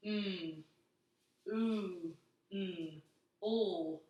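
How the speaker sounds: mm and ooh held long, sound by sound; slow stretched mm ooh, soft; sounding out moonlight. Shown as a demonstration. Teacher sounding out moonlight to children. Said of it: "Let's sound that out".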